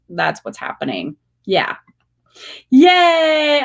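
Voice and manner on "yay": high, loud joyful shout; cheering yay. Real, not acted. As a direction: cheer it out like a fan. Cheering a viewer.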